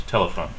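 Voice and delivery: "telephone" as TEL-uh-phone; "telephone" falling away after a stressed first syllable. Shown as a demonstration. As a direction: put the weight on "tel" in "telephone". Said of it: "We stress the first syllable in English".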